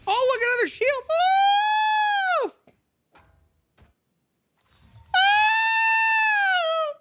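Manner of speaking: real shouts of joy at a lucky drop; excited shout. Real, not acted. Streamer sees loot drop.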